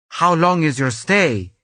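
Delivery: a hint of a Belgian officer's accent. Customs agent asking Candace about her stay, lightly Belgian accent. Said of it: "in his intoxicating Belgian accent".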